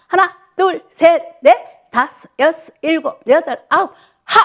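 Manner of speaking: calling the count. Real, not acted. 어르신들 박수에 맞춰 크게 외치는 구령. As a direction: drive the class through the claps. Instructor calls clap count.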